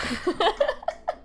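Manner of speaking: bright rising giggle; high giggle. Real, not acted. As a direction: giggle at yourself. Laughing at own accent.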